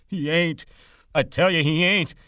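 Lenny with hands over his ears, frantic; desperate denial. A narrator's reading. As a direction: shout it out to block the words.

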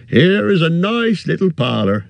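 a fussy, friendly innkeeper bending down; cheery innkeeper. A narrator's reading. Innkeeper greets the hobbit, high and bustling.

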